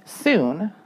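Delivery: usual speaking voice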